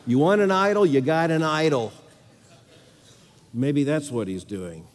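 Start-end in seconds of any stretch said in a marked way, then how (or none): 0.1-1.9 higher, louder preaching surge; full-force preaching. Acted. Preacher to congregation, loud preaching at full force. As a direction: preach it hard, pressing the point.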